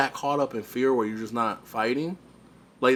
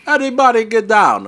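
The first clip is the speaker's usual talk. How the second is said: put-on loud, high Middle Eastern hijacker accent; 8 semitones higher, 8 dB louder than usual. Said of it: "I'm gonna do my quickie mark".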